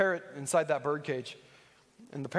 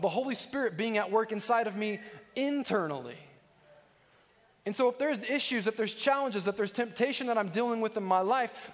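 higher-pitched, driving sermon delivery; forceful preaching; 5 semitones higher than usual. Real, not acted. Preacher explaining self-control to the congregation, urgent preaching. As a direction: press the teaching home with urgency.

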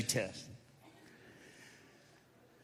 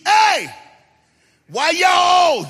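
put-on a kid's shout, much higher and louder; yelling kid; 18 dB louder, much slower than usual. The boy yelling to his parents in the front seat, high and loud. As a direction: yell it from the back seat. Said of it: "the boy yells to the front seat".

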